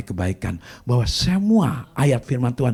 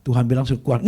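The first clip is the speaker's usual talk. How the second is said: ordinary delivery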